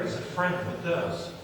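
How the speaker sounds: normal talking voice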